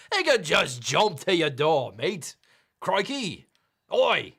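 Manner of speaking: hammed-up Aussie with punchy exclamations; bad Australian accent. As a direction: throw in cartoon Australian slang. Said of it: "I gotta do this in the accent".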